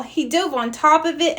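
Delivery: ordinary delivery, nothing marked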